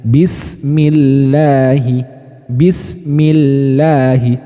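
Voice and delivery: bismillahi with a light, thin lam, very slow; slow tarqiq bismillahi; read thin. Shown as a demonstration. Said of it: "tarkik dibaca tipis".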